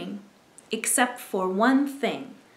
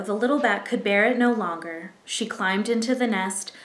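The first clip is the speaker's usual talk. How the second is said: plain reading voice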